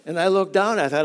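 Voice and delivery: ordinary talk, nothing marked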